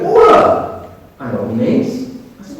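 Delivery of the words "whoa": high, loud startled exclamation; mock startled cry; surprised whoa. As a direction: blurt it out in shock. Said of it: "She goes".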